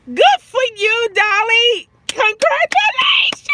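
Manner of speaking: loud, high, cheering congratulations; celebrating a viewer's news; excited cheer. Real, not acted. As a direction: shout it out with delight. Cheering viewer's new phone setup.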